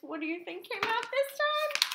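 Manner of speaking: high-pitched eager question; excited teasing. A narrator's reading. Teasing the listeners before reveal.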